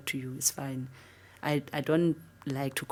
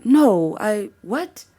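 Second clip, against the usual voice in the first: higher, louder stammered denial; flustered disbelief; 7 semitones higher, 10 dB louder, much slower than usual. Retold or imitated. Caught off guard by the reporter, loud flustered denial. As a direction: stumble over it in shock. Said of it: "I'm like".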